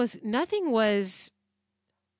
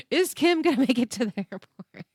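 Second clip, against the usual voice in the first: real laugh breaking through a high question; 7 semitones higher than usual. Real, not acted. Host to listeners, laughing through the question. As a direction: giggle through the cliffhanger.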